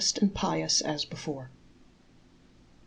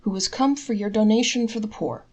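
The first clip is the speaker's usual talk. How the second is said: apologetic; 5 semitones higher, 5 dB louder than usual. A narrator's reading. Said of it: "he told him apologetically".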